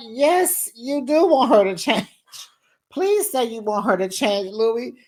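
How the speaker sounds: hammed-up plea with a laugh breaking in; mock pleading. Acts out pleading with Louis.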